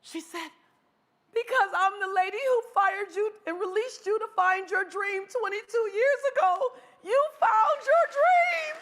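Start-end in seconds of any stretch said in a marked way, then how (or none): 1.3-8.7 hammed-up crying, imitating an overwhelmed woman; acted tearful wailing. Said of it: "Why are you crying so much".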